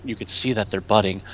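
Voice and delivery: low, creepy murmur. Real, not acted. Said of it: "Did I say that in a low, creepy voice?".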